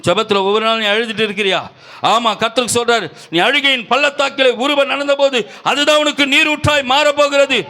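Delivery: high, driving preacher's cry; impassioned preaching. Real, not acted. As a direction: build from urging to a shouted promise. Preaches at full force.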